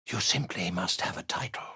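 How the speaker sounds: normal talking voice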